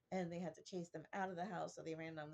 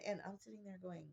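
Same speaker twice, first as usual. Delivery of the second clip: ordinary talk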